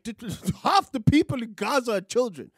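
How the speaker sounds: ordinary talk, nothing marked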